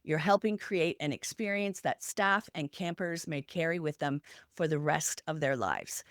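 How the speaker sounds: normal talking voice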